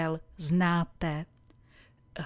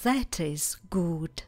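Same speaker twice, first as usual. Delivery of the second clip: slow whisper; slower than usual. Shown as a demonstration. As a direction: slowly whisper "That is good" for repetition. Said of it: "opakujte po mně". (pomalu šeptem „That is good“)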